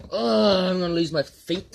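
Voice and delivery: loud, high cry of cold; pained cold yelp. Real, not acted. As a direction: yell through the icy shock. Crying out in icy water.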